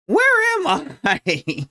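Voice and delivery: high, slow, bewildered question. Real, not acted. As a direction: sound completely lost. Lost after falling.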